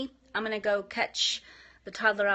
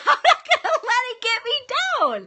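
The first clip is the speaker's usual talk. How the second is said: high, loud laughing words; a real laugh bursting through; 11 dB louder than usual. Real, not acted. Laughs through her resolve.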